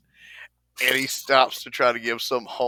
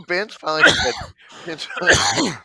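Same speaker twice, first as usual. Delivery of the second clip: laughing; 13 semitones higher, 7 dB louder than usual. Real, not acted. Cracking up while retelling the phone call.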